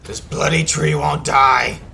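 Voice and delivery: loud, slow ratman creature voice; badly practiced Skaven, pushed out loud. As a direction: snarl it slowly like an amateur rat villain. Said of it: "work on my Skaven voice".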